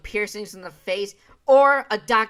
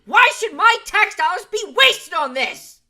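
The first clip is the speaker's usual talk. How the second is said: loud, high-pitched angry rant; outraged shouting; 11 semitones higher, 8 dB louder than usual. Real, not acted. Commentator challenging spending of tax dollars, outraged shout.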